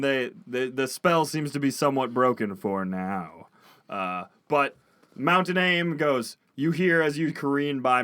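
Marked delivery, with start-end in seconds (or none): none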